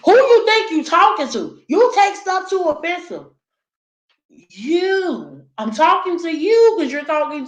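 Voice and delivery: an offended uncle raising his voice; angry uncle. Retold or imitated. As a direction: snap back, offended.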